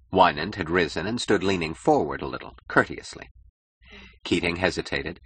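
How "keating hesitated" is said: ordinary delivery, nothing marked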